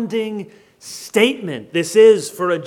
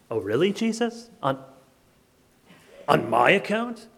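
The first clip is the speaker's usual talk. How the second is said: quiet, skeptical query with a rising end; softly taken aback; incredulous questioning; 3 semitones lower, 4 dB quieter than usual. Acted. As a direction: question it doubtfully, under-spoken. Skeptical hearer questions Jesus's claim.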